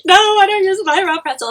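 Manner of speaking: high, quick mock-innocent denial; retold protest. Retelling own reply to the waxer.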